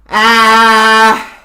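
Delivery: fast loud ah with all the air; saying ahh. Shown as a demonstration. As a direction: dump all the air in one ah. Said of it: "I want all the air immediately".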